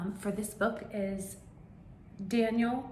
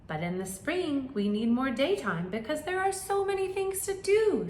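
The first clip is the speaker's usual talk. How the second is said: bird voice; 7 semitones higher, 5 dB louder than usual. Acted.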